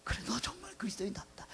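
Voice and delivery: hushed, whispery admiration; imagined onlooker's reaction. Pretend. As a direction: drop to a near whisper, as an impressed onlooker. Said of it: "세상 사람들이 우리에게 뭐라고 반응할". (세상 사람이 속삭이듯 감탄)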